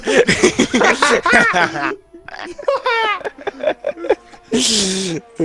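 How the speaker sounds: long, high burst of laughing; helpless laughter. Real, not acted. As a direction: laugh on and on uncontrollably. Co-commentators laughing at the mistake.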